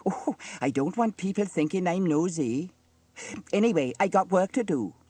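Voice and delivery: high-pitched busybody brushing it off; nosy mother, huffy. A narrator's reading. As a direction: excuse yourself briskly in a high voice. Narrator voices Dilys.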